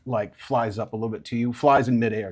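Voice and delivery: ordinary talk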